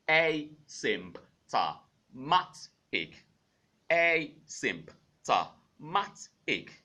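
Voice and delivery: asymptomatic stretched out very slowly; slow syllable drill. Shown as a demonstration. Teacher drilling asymptomatic very slowly. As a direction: draw out every syllable of asymptomatic. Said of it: "let's bring it out to words now".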